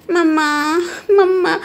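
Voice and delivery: whiny wail for mama; acted childish crying. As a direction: call out tearfully as a little child, drawing out the word. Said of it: "रो क्यों रहे हो?".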